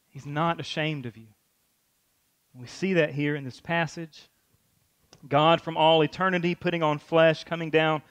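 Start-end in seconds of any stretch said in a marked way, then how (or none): none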